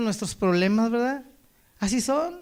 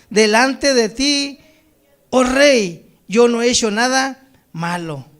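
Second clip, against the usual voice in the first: scripture reading; 5 dB louder than usual. Acted. Preacher reads Daniel's answer to the king, measured proclamation. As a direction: proclaim each phrase with weight.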